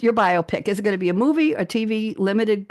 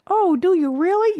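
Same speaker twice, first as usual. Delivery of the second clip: much higher, louder mock voice; 11 semitones higher, 4 dB louder than usual. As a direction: play the surprised listener. Mock exchange about talking fast.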